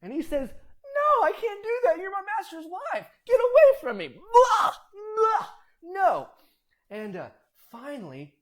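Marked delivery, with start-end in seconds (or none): 0.5-6.3 much higher and louder, shouting; frantic shouted refusal. Acted. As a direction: yell at her to get away. Joseph refuses again, pushes away.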